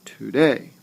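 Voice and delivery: Today, weak To, emphasized day; stress on second syllable. Shown as a demonstration. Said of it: "I put the emphasis on day".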